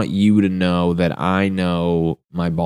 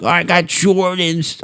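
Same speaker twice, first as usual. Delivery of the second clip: bragging manchild; 10 semitones higher, slower than usual. Retold or imitated. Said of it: "guys my age are still like".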